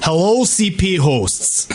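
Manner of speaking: put-on Russian accent sliding toward Austrian; attempted Russian accent. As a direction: ham up a thick Russian greeting. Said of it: "read in a thick Russian accent".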